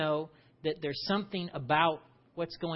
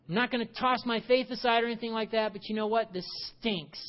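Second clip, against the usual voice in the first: quick, higher, fed-up grumble that trails down; Martha venting; 6 semitones higher, 3 dB louder than usual. Pretend. Martha vents to the questioner, fast and exasperated. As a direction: rush the complaint, then sink into "This stinks". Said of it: "So how are you right now?".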